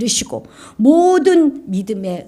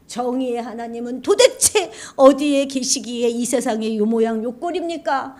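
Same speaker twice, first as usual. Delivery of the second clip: ordinary delivery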